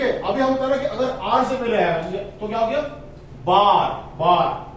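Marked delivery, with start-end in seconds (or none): 3.4-4.7 बार, बार drawn out slowly with a long aa. Shown as a demonstration. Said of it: "वो आ का sound करता है".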